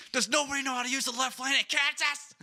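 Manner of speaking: yelling at a Kansas driver in the left lane; exasperated rant. Retold or imitated. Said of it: "I was like".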